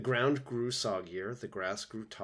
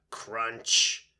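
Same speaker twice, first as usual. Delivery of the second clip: louder, emphatic crunch; sharp crunch; 7 dB louder than usual. A narrator's reading. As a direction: punch the sound word out loud. Sound word in narration.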